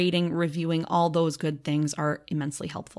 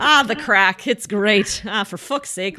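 put-on Irish accent; 2 semitones higher, 5 dB louder, slower than usual. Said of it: "my Irish accent".

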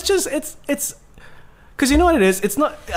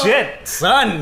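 ordinary talk, nothing marked

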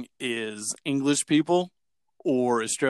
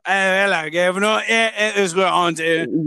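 higher put-on British accent; mock British accent; 5 semitones higher than usual. Imitating the ones who talk that way, mock British accent. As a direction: caricature of rough British street speech. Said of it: "they're the ones that would be like".

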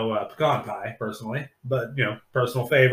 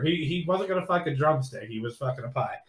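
ordinary delivery

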